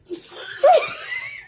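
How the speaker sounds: high squealing burst of laughter; big shrieking laugh. Real, not acted. Friend cracking up at her own story, squealing laugh. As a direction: let out a shrieking cackle.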